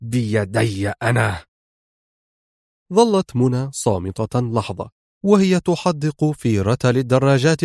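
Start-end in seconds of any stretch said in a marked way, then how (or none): 0.0-1.5 slow, measured fury; understated rage, each word drawn out; enraged. A narrator's reading. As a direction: spell out the threat slowly. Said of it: "في ثورة".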